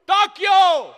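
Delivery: high, very loud hammed-up yell; mock shouting mayor. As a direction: bellow it like a pompous mayor. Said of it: "¿por qué chillamos?".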